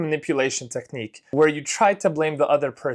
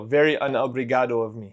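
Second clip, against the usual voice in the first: normal talking voice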